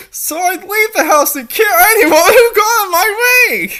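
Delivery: can't keep a straight face while reading; laughing. Real, not acted. Laughing while reading absurd line.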